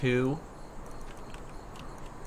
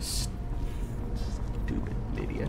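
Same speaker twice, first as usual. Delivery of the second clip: whispered insult; 7 dB quieter than usual. Real, not acted. Annoyed at companion.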